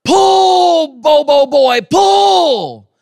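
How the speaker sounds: high shouted farmer's holler; hollering at a mule. Retold or imitated. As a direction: yell at the mule to pull. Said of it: "and he would holler".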